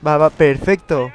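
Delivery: loud, slow, satisfied; triumphant cheer. Real, not acted. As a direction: savour the win. Mortar destroyed. (celebración triunfal)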